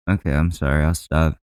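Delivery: ordinary talk, nothing marked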